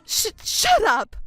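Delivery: stammering, high and sharp, slowed; angry outburst. Acted. Mother snaps at stepdaughter, flustered high angry outburst. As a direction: cut her off in a flustered rage.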